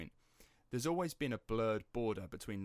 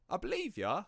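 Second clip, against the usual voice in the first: high, louder mock-credulous reply; humouring; 10 semitones higher, 5 dB louder than usual. Grandson to step-granddad about his Krays story, humouring. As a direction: humour a tall tale, pitched up high.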